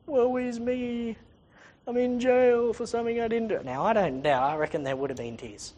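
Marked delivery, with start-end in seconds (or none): 0.0-3.6 tearful poor-me imitation; mock crying. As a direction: moan it in hammed-up self-pity. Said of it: "sitting in a hole crying".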